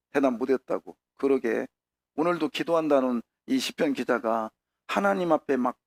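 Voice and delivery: ordinary delivery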